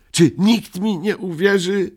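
high, loud exasperated cry; a child's high anguished outburst. A narrator's reading. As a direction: burst out in despair. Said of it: "wybuchnął Muminek".

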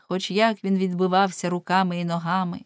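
ordinary delivery, nothing marked

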